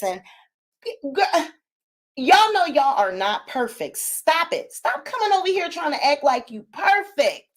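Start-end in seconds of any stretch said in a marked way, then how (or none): none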